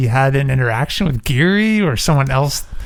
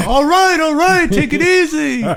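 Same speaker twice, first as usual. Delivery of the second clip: much higher put-on voice; high mock Fredo; 13 semitones higher than usual. Calming a hothead, high put-on voice. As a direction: soothe in a high silly voice.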